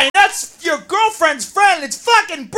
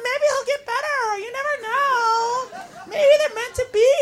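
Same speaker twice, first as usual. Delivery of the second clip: high, soft, cooing whine; mock dreamy sympathy, pitched up; hopeful girlfriend; 7 semitones higher, 8 dB quieter than usual. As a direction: offer naive comfort in a girlish voice. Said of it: "I'm like".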